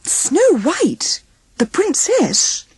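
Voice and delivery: high, awed question; amazed. Acted. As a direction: awestruck disbelief. Dwarfs amazed.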